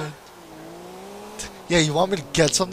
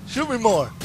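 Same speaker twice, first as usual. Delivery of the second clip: ordinary talk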